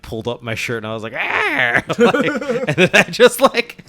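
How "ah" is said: high, loud goblin shriek; goblin screech. Retold or imitated. Game master retelling a goblin song performance, high screech. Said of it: "like, the goblin".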